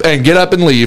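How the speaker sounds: normal talking voice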